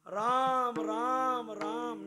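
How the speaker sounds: dying father crying for his son; anguished cry. Acted.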